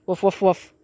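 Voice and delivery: spoken woof woof imitating the dogs; lightly voiced dog barks; mock dog barks. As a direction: bark back at the dogs playfully.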